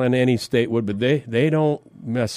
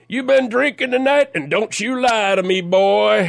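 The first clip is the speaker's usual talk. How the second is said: put-on southern sheriff accent; 9 semitones higher than usual. Retelling his arrest, imitating the sheriff's Southern accent. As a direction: play the big Southern sheriff grilling a kid. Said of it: "Big guy with a big southern accent".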